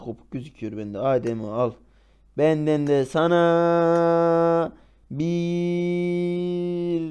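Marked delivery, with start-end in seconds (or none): 2.4-7.0 very slow stretched teasing; drawn out. Real, not acted.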